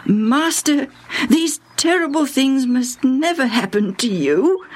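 high, urgent protest from Peter; Peter pleading. A narrator's reading. Peter protesting to his master, high and urgent. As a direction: beg him to stop, high and pressing. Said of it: "When Peter said".

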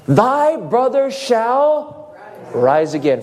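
slow, drawn-out solemn words; solemn quote. Acted. Preacher voicing Jesus' promise to Martha, slow and solemn. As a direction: let Jesus' promise ring slowly.

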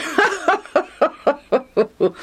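long high laughter; hearty laugh. A narrator's reading. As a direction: laugh along with the story. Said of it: "Mister Legion joined Lucius in laughter".

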